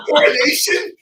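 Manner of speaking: laughing through the word; giggling while saying it. Real, not acted. Laughing at getting covid there.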